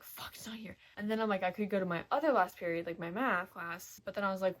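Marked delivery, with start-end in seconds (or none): 0.0-0.8 hushed whisper to yourself; soft, deflated whisper; whispered dismay. Retold or imitated. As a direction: whisper the curse under your breath. Acts out searching the floor.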